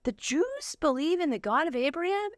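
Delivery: high, amazed retelling of a discovery; realizing it all at once, voice climbing; surprised realization. Retold or imitated. Retold realization, voice jumps high.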